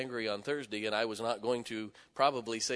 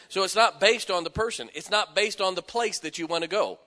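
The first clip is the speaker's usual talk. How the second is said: raised, forceful sermon voice; emphatic preaching; 7 semitones higher, 9 dB louder than usual. Real, not acted. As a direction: preach it with conviction, louder.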